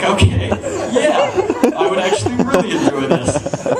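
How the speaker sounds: high, slow, stretched-out okay yeah; eager yes. Retold or imitated. Young man eagerly accepting the invitation, high and drawn out.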